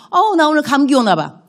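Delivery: mock whiny complaint. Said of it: "만난 사람마다".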